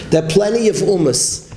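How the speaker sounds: ordinary talk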